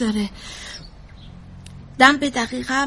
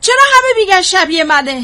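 loud, higher-pitched protest; upset outburst; 4 semitones higher, 8 dB louder than usual. Acted. Mother protests to therapist at the resemblance, loudly upset. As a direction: burst out in exasperated protest. Upset at being compared with daughter.

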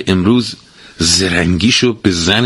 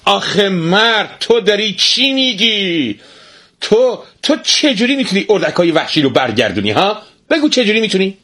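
shout; 14 semitones higher than usual. Acted. Wife yells at foolish husband, very high. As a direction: yell it up high at him. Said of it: "بر سر مرد فریاد کشید".

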